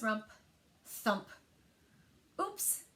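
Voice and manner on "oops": higher, softer sheepish oops; embarrassed oops. A narrator's reading. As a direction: let it slip after the crash. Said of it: "cried Froggy".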